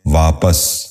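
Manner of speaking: واپس said as "vaa-pas", short a in the second syllable; correct pronunciation. Shown as a demonstration. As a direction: end واپس clearly in "-pas". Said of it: "درست تلفظ ہے".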